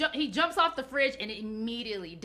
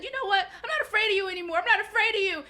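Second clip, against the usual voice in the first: defiant Will; 9 semitones higher, 4 dB louder, faster than usual. Retold or imitated. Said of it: "Will said".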